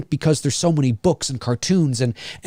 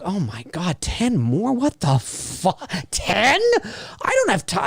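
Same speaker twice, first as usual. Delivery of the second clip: higher, slowed, hushed mock dismay; acted-out incredulous groaning; overwhelmed disbelief; 4 semitones higher, 4 dB quieter, slower than usual. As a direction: react as if swamped by the sheer amount. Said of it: "I'm just like".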